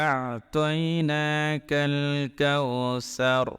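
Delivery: slow, drawn-out chanted recitation; Quran recitation. Shown as a demonstration. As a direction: recite with long melodic vowels. Recites verse slowly.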